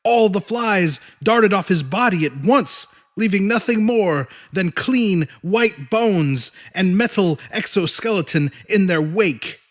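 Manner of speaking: usual speaking voice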